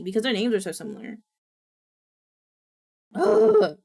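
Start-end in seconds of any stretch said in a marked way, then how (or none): 3.1-3.8 loud, high groan of frustration; upset groan. Real, not acted. Streamer upset at own name mix-up, frustrated groan. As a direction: let out a frustrated ugh. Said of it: "So upset now".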